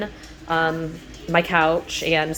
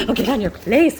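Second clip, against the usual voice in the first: pet voice command; 5 semitones higher, 5 dB louder than usual. Real, not acted. Directing the dog to its spot.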